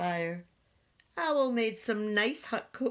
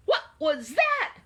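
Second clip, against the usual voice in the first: high, loud alarmed question; startled; 9 semitones higher, 6 dB louder than usual. A narrator's reading. Owl hearing a knock in the storm, startled. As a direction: jump at the noise, pitch rising.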